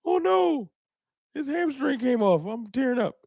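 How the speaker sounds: high, hammed-up whimpering lament; mock crying. Host mocking a fan who cried over Dak's hamstring, hammed-up weepy voice. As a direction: overplay a fake tearful fan. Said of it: "Why would I cry?".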